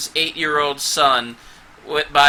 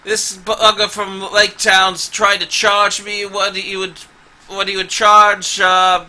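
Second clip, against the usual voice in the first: loud, higher, furious rant; furious dwarf; 4 semitones higher, 6 dB louder than usual. Acted. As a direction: fume at the merchant. Angry dwarf rants about prices.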